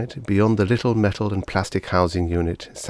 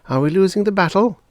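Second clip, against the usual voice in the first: put-on boy's worried question; 13 semitones higher, 6 dB louder, faster than usual. A narrator's reading. Boy asks father about war.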